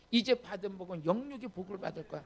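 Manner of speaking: ordinary talk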